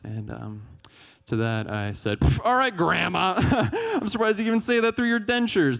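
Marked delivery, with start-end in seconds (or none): 2.2-5.8 loud, high-pitched jeer with a laugh; mocking comeback. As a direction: taunt her as a doddering granny. Acted mocking comeback to his mom.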